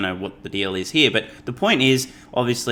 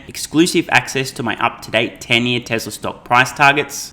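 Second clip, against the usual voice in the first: louder, upbeat sponsor-style pitch; channel promo read; 4 dB louder than usual. Acted. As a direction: sell the perks like an ad.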